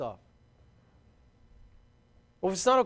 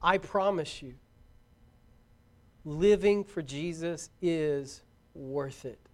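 earnest preaching appeal; 2 semitones lower, slower than usual. Real, not acted.

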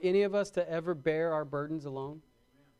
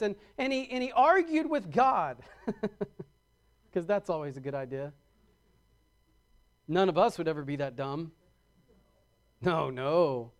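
usual speaking voice

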